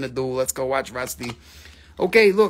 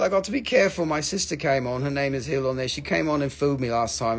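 ordinary delivery

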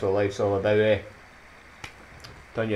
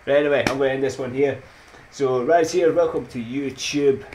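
louder, higher outro; loud sign-off; 6 semitones higher, 8 dB louder than usual. Real, not acted. Closing the vlog to viewers, loud upbeat sign-off. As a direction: sign off with energy.

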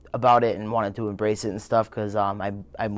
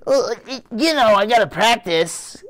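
high, slow, sing-song mom voice; a mother brushing it off, pitched high; mom's excuse; 10 semitones higher than usual. Retold or imitated. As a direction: play the innocent mother, lifted and drawn out. Son voicing his mother's excuse.

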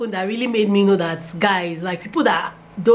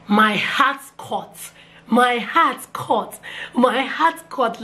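dramatic slow; 3 semitones higher, much slower than usual. Real, not acted. Moment before reading the test.

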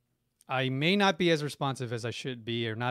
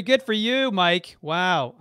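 high, loud burst of real delight; genuinely thrilled shout of congratulations; excited praise; 5 semitones higher, 8 dB louder, slower than usual. Real, not acted. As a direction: cheer him on, thrilled. Viewer tells of beating cancer.

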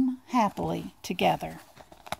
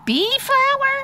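very high, tiny mouse voice; wondering little mouse, pitched way up; murmuring Little Mouse. Acted. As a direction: say it as a small, amazed mouse. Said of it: "murmured Little Mouse".

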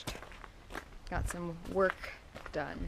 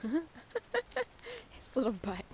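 cooing; 7 semitones higher, 7 dB quieter, much slower than usual. Real, not acted. Cooing at the dog.

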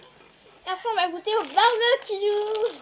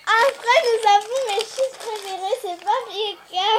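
loud and high, thrilled; excited; 4 semitones higher, 8 dB louder than usual. Real, not acted. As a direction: announce your favourite with glee. Announcing favourite chips.

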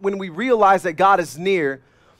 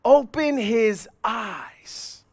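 slowly, drawing out "open his eyes"; slow prayer; 3 semitones higher, much slower than usual. Acted. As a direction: linger on each word of the prayer. Preacher voicing the prayer slowly.

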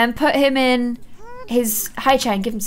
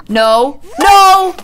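higher, much louder cry of No!; dismayed shout; 5 semitones higher, 7 dB louder than usual. Real, not acted. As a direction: yell as the food hits the floor. Baby throws food on floor.

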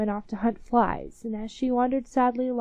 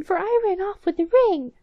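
indignant mouse; 10 semitones higher, 6 dB louder than usual. Acted. Mouse quarrel continues.

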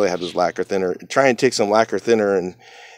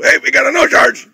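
called out loud and high; loud announcement; 8 dB louder than usual. Retold or imitated. Manager announcing the no charge to everyone, loud call. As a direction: announce it so the whole shop hears. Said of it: "he would say it really loud".

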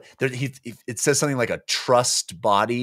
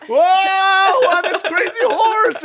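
much higher, louder whoop; hammed-up runaway rider shout; wild yell; 8 dB louder than usual. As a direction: holler while riding out of control. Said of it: "Korab is like".

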